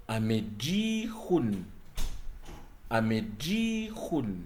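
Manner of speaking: "amejihun" spoken slowly, every syllable spaced; slow example model. Shown as a demonstration. Teacher slowly models "amejihun" twice for learners. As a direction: draw out "amejihun" at half speed.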